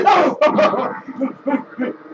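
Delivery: burst of laughter. Real, not acted. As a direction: laugh it off after a loss. Laughs after losing.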